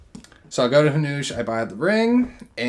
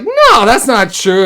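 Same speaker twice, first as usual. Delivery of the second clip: panicked denial; 10 semitones higher, 12 dB louder, slower than usual. Retold or imitated. Panicked denial to angry dad.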